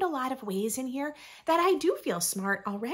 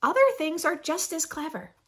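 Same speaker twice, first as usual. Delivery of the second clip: lively, sing-song picture book reading; reading to children; slower than usual. Acted. Reading a rhyming book to young listeners, bright storytime voice. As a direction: read it like storytime for little ones.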